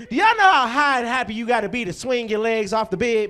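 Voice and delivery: ordinary talk, nothing marked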